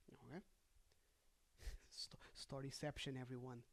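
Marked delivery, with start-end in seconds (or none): none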